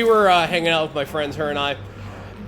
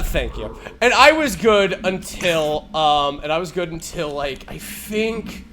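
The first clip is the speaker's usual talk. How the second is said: usual speaking voice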